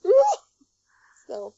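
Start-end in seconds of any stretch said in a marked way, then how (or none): 0.1-0.4 high, loud startled yelp; cartoon yelp. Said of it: "And go".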